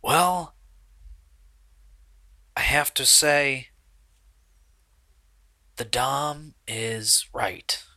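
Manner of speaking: hesitant confession in character; reluctant confessor. Acted. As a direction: own up haltingly, voice partly hushed. Game master voices accused Steve confessing.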